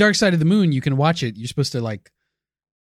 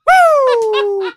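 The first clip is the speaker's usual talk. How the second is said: loud, high whoop of glee; whooping cheer; 12 semitones higher, 6 dB louder than usual. Real, not acted. Cohost cheers the moon Nazi theory, whooping loud and high. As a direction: cheer like your team just scored.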